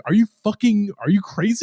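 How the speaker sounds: high, mock-disbelieving outburst; incredulous producer. Sokolov questioning Arthur's sanity in mock disbelief. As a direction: play the baffled producer who can't believe it. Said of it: "And Sokolov's like".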